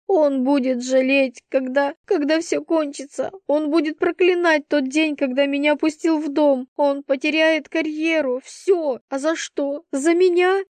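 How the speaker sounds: high, agitated lament; distraught, climbing to an anguished question; hysterics. A narrator's reading. Girl predicting his regret to her friend, hysterical. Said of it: "И я билась в истерике".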